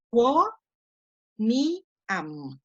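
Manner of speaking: "quo, ni, am" split into three separate syllables. Shown as a demonstration. Teacher dividing a Latin word for students, syllable by syllable. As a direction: spell out "quo, ni, am" with a pause between syllables. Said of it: "la primera palabra se dividiría".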